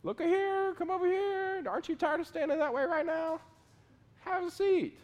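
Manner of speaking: a needling high voice luring the soldier from his post; tempter voice. Retold or imitated. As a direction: sweet-talk the guard into sitting down. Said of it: "somebody can get up in my face and go".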